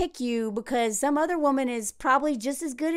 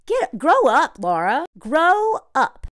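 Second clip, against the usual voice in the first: louder, higher exasperated scolding; 3 semitones higher, 5 dB louder, slower than usual. Real, not acted. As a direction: tell her off like a fed-up big sister. Scolding Laura at the screen.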